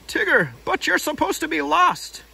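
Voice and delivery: startled Rabbit voice; surprised Rabbit. Acted. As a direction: gasp it out in disbelief.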